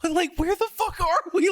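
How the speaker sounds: high, panicked question; freaking out. Retold or imitated. Tripping Sam panicking about where they are, high and frantic. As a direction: blurt it out in sudden panic. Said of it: "I started freaking out".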